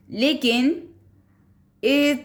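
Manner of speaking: usual speaking voice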